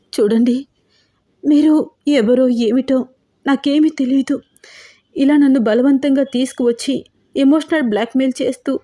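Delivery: put-on hurt, tearful girl's voice; tearful protest. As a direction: plead and reproach through tears. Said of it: "రూప కళ్ళల్లో కన్నీళ్లు తిరుగుతున్నాయి".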